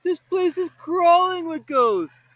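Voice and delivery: panicky. Acted. Shaggy panicking.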